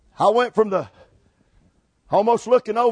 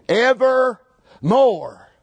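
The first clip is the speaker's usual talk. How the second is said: two separate weighty words, Ever ... more; emphasis on each word; much slower than usual. Shown as a demonstration. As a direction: land Ever, pause, then let more fall. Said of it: "I just want to emphasize it".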